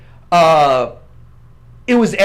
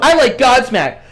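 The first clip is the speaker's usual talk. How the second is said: put-on higher whiny teenager voice; sulky teen listing bands; bratty teen voice; 6 semitones higher, 3 dB louder than usual. Voicing his bratty teen self.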